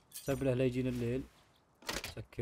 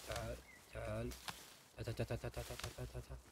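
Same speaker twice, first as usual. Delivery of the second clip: soft coaxing call; 12 dB quieter, much faster than usual. Real, not acted. Coaxing something closer in game.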